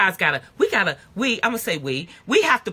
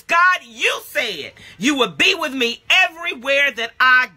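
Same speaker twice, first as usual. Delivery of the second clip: shouted, higher prayer to God; passionate shout; 5 semitones higher, 3 dB louder than usual. Real, not acted. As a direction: cry out God's promises, not angry, passionate. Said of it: "I don't say it like you're mad".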